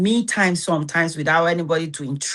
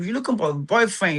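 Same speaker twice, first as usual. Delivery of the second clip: ordinary talk, nothing marked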